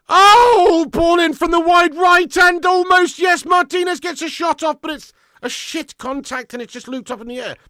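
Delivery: high, loud cry calling a near goal; excited shout. Real, not acted. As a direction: yell the move as it unfolds. Live chance commentary, crying out.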